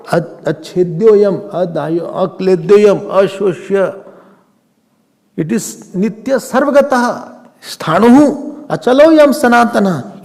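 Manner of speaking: intoning a Gita verse to students; verse recitation. Real, not acted. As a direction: chant the Sanskrit slowly and firmly.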